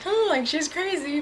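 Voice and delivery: mock disbelief. Imagines the couple's reaction to her.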